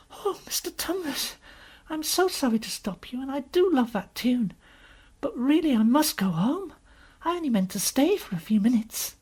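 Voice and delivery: put-on high, light girl's voice, apologetic; polite little girl. Lucy telling the faun she must leave, high and apologetic. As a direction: voice an earnest child, pitched well up.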